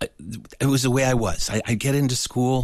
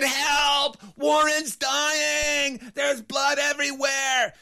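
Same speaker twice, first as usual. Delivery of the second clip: desperate shout for help; much slower than usual. Retold or imitated. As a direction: call through the door as if a life depends on it. Kids shout for teachers' help.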